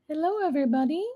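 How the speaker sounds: usual speaking voice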